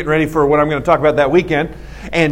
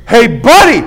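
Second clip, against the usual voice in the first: shouted challenge across the store; loud confrontational hey buddy; loud call-out; 6 semitones higher, 7 dB louder, slower than usual. Retold or imitated. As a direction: call him out loudly. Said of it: "I mean, just that loud".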